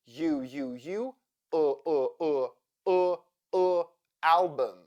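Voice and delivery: U repeated, then UH repeated, into album; schwa drill. Shown as a demonstration. Teacher drilling the unstressed second syllable of album, schwa drill. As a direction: drill U turning into a neutral UH before album. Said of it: "U sounds like uh".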